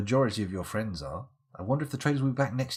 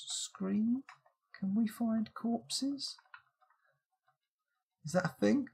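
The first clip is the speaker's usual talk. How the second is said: ordinary talk, nothing marked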